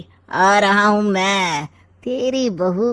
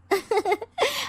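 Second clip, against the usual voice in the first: sly laugh; 13 semitones higher, 5 dB quieter than usual. Acted.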